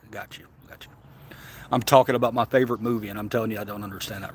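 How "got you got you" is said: hushed aside. Real, not acted. Quiet aside after chat confusion.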